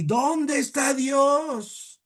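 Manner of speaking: high, drawn-out booming cry; preacher's shout. Retold or imitated. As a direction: bellow it slowly like a preacher from the pulpit. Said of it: "y gritaba".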